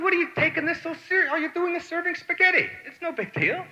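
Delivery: very high-pitched retold plea; squeaky acted exasperation; incredulous questioning. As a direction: question him in mock disbelief, way up high. Voices himself questioning frantic waiter.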